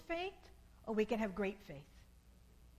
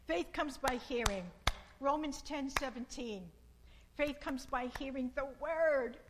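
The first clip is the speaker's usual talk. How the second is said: louder, emphatic scripture proclamation; preaching; 3 semitones higher, 6 dB louder than usual. Acted. Preacher proclaiming Romans to the congregation, loud and emphatic.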